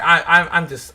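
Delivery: normal talking voice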